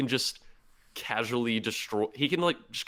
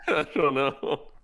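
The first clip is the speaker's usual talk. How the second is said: higher, slow laughing reply; 6 semitones higher, slower than usual. Real, not acted. Podcaster asked why he is still here, laughing. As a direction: answer helplessly through laughter.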